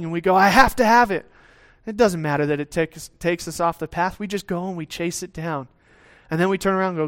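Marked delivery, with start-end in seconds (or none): 0.3-1.3 higher and louder, greedy craving; a believer distracted by something shiny, eager and louder; eager, excited grab. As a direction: blurt it like a dog spotting a ball. Greedy chasing impulse.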